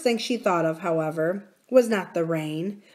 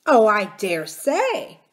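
dismissive nurse; 3 semitones higher, 6 dB louder, slower than usual. A narrator's reading.

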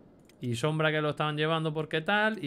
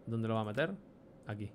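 usual speaking voice

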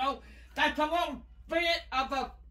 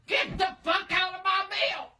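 higher-pitched furious order; angry yell; 5 semitones higher than usual. Real, not acted. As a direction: yell at him to get away. Grandma orders grandson off her milk.

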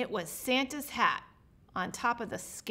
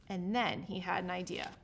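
ordinary delivery

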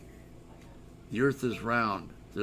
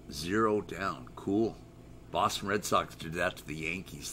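ordinary talk, nothing marked

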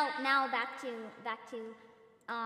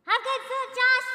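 calling out; 11 semitones higher, 10 dB louder than usual. Real, not acted. Calling out to a viewer.

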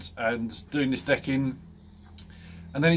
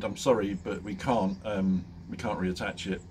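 grave doctor; 5 semitones lower, 5 dB quieter than usual. Retold or imitated. Doctor delivers bad news.

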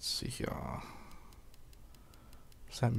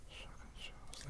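whispering; 22 dB quieter than usual. Real, not acted. Whispering item name.

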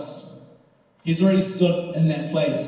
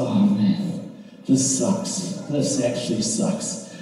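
low, defeated mumble; deflated; 7 semitones lower, 5 dB quieter, slower than usual. Retold or imitated. Student discovering the cracked phone after the fall, deflated. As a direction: let the voice sink in retold dismay.

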